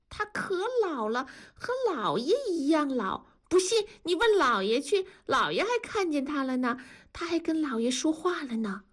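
put-on high, eager child's voice; little girl 改改. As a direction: play a delighted little girl. (高声童音，兴奋的小女孩)

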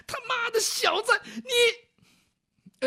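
high, strained cry of pain and rage; pained curse. Acted. Ruffian with hurt hand curses the scholar, pained cry. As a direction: curse through a throbbing wrist.

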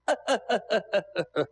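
staccato acted bandit cackle; villain laugh. Bandit gloats over his captive, staccato villain laugh. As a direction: laugh in a rapid, even string of bursts.

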